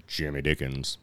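low, quiet deadpan correction; a hint of a stern correction; correcting Jimmy Dickens. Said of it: "he corrects him real quick".

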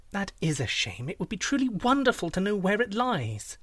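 high, wistful princess voice; wheedling princess. Acted. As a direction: coax the secret out with sweet regret.

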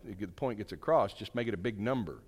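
ordinary talk

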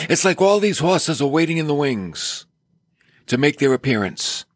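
normal talking voice

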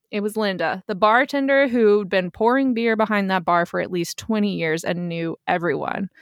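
plain reading voice

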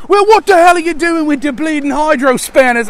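high, loud Cockney shouting; Cockney yelling Chewbacca. Acted. Chewie rowing with Han while fixing the Falcon, Cockney yelling. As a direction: yell it indignantly in a put-on Cockney accent. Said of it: "Cockney yelling Chewbacca".

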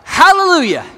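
high, loud burst of hallelujah; joyful shout. Retold or imitated. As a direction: let it burst out in joy. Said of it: "everybody's like".

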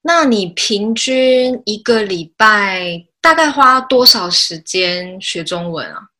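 usual speaking voice

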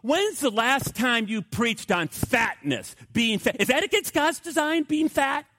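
a hammed-up taunting heckler voice; mocking heckler. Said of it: "He goes".

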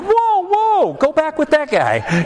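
very high, drawn-out comic whoas; hammed-up high-pitched protest, slowing down; mock alarm. Dad playfully sending his punching son away, mock alarm. Acted startled reaction to being punched.

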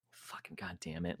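whispered, under the breath; muttered curse. Retold or imitated. Kvax typing the post, cursing in a whisper. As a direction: curse to yourself so nobody hears. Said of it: "Kvax, is probably like".

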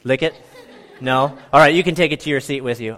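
normal talking voice

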